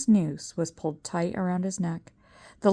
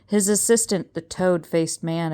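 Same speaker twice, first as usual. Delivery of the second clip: usual speaking voice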